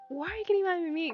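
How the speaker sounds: high, quick mock complaint; whiny protest. Owner retelling her protest to grandma, whiny.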